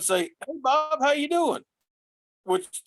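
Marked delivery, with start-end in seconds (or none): 0.2-1.6 cheery greeting. Retold or imitated. Cheerful greeting to toll worker.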